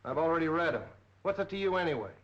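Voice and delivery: loud, high and hard-pressed questioning; angry grilling. Acted. Detective grilling a nervous office manager, loud and angry. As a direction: bark the questions at him, demanding.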